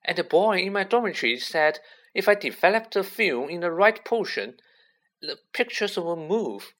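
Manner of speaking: high, eager fan's chatter. A narrator's reading. Colin telling Harry about developing the film, eagerly. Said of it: "said Colin Creevey eagerly".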